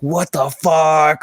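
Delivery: drawn-out, stunned exclamation; re-enacted awe, very slow; mind-blown. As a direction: stretch it out in amazed disbelief. Acting out his mind-blown reaction.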